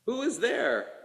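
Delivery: sudden high, anxious question; a frightened sick widow calling through the window. A narrator's reading. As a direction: call out, startled and scared. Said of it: "asks a frightened voice".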